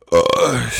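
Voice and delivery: speaking through a burp; words pushed out on a burp. Real, not acted. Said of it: "I'm burping a lot this podcast".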